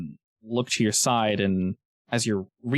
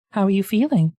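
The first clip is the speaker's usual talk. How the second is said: higher-pitched, put-on snake voice; talking snake; 9 semitones higher, 4 dB louder than usual. Snake sizes up the adventurer, inquisitive creature voice.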